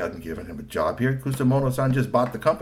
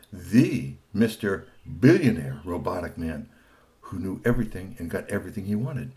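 ominous narration; 3 semitones lower than usual. A narrator's reading. Narrator introducing the villain, low and ominous. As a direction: make his entrance feel heavy.

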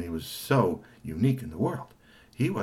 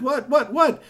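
high, loud startled questions; alarmed; 6 dB louder, slower than usual. Acted. Partner running out, high alarmed what. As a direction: rush in asking.

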